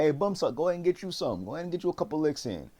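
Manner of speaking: high-pitched imitation of goading; mock egging on. Bullies inviting Bumsuck to hit, high egging on.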